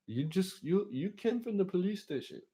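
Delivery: high put-on driver voice; cab driver. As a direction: explain in a high, squeaky imitation.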